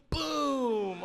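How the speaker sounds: high, loud boom; shouted boom. Retold or imitated. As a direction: bang it out. Cashier's fireworks exclamation.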